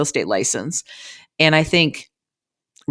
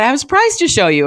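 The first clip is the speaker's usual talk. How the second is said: much higher, fast, teasing sing-song; excited surprise; 8 semitones higher, 3 dB louder, faster than usual. Retold or imitated. Daughter teasing her parents about a surprise, high and eager. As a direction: bubble over with a secret. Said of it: "And I said".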